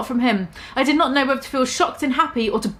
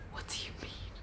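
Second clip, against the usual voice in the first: whisper; 24 dB quieter, faster than usual. Acted. Whispered quote in story.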